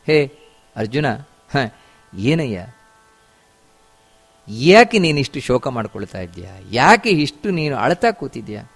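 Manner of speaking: low, soft questioning of a grieving friend; Krishna. Acted. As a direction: gently take a friend to task, voice dropped low and quiet. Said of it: "ಅಂತ ಹೇಳಿದ".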